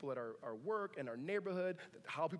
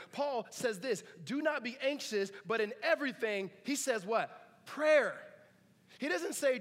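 higher, louder, pounding each phrase; fervent preaching; 6 semitones higher, 5 dB louder than usual. Acted. Pastor preaching to his church about prayer over anxiety. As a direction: drive the verse home to the congregation.

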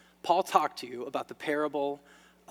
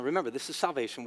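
ordinary talk

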